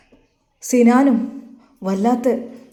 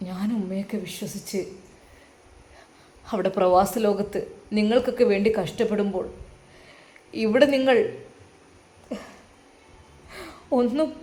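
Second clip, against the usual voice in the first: softer broken reproach with long pauses; weeping son; 5 dB quieter than usual. A narrator's reading. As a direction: speak through held-back tears to his mother. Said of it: "അവൻ ആ സോഫയിലിരുന്ന് വിതുമ്പി".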